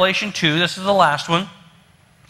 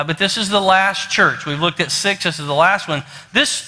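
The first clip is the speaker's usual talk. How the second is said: usual speaking voice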